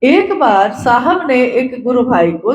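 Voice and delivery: normal talking voice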